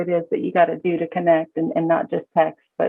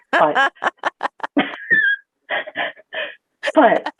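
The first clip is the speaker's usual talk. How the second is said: real laugh; 13 semitones higher than usual. Real, not acted. Laughing at own put-on accent.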